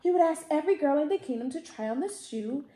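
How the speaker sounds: plain reading voice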